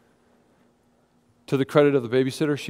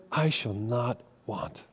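soft, slow, hushed and certain; quiet declaration; 5 semitones lower, 9 dB quieter, much slower than usual. Acted. David quietly declaring contentment, slow and hushed. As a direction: let it settle, slow and soft.

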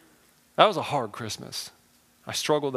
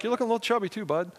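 teasing self-talk; 8 semitones higher, faster than usual. Retold or imitated. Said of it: "I look in the mirror and I'm like".

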